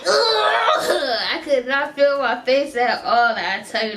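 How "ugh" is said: high laughing ugh; laughing groan. Retold or imitated. Numb face reaction.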